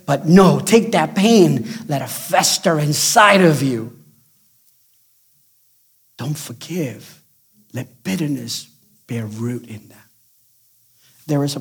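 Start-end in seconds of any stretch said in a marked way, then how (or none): 0.0-10.1 villain's advice. Retold or imitated. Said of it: "he says".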